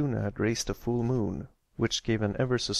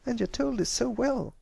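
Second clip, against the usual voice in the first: put-on much higher, grinning young filly; 13 semitones higher than usual. Applebloom praises Pipsqueak's story, grinning. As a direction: praise him with a big grin.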